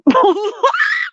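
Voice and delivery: shouted high burst of laughter; loud high laugh. Real, not acted. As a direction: cackle out loud. Laughing at own story.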